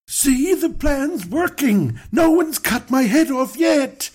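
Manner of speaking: high goofy bro gloating to his wife; smug Abram. Pretend. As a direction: gloat like a kid whose plan worked.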